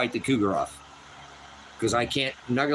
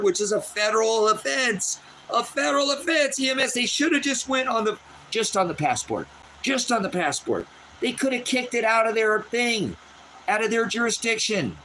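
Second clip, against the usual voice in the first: much higher, insistent rant about the offense; worked-up rant; 10 semitones higher than usual. Real, not acted. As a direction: hammer the point home, voice climbing high. Ranting about the passport offense.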